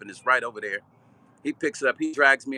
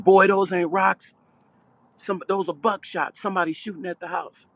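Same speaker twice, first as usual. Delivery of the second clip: put-on urgent older brother; 7 semitones higher, 4 dB louder than usual. Older brother warning about gunfire.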